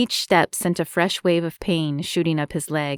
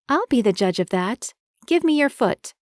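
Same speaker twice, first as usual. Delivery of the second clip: bossy woman voice; 5 semitones higher than usual. Acted. Marie takes charge.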